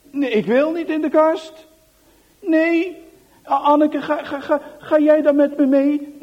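high, loud tearful pleading; crying child. Acted. Johan crying not to go in the cupboard, tearful high plea. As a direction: beg through tears, scared. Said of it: "Johan begint te huilen".

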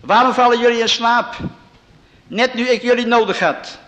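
loud, high rebuke; shouting. A narrator's reading. Preacher reading Jesus scolding the sleepers, loud and high. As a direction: keep yelling at them in dismay. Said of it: "schreeuwde Jezus".

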